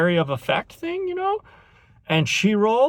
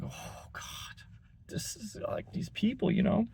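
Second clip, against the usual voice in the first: softer, whispered "oh God" to a fellow customer; whispered aside; 5 semitones higher, 11 dB quieter than usual. Retold or imitated. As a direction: breathe it out so she can't hear. Silent look shared with the guy.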